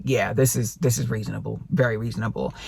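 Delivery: low, soft imitation of agreeing agents; quiet agreement. Sellers' agents conceding to the buyer's agent, quiet agreement.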